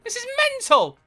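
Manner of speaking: high, loud, hyped disbelief; wild, real excitement; amazed shouting. Real, not acted. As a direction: yell it to share your amazement. Amazed at piles of ammo bags.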